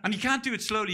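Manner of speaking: usual speaking voice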